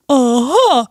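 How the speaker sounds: high, drawn-out triumphant yell; hammed-up cry of victory; yelling bear. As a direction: gloat as the storybook bear. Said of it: "yells Mister Bear".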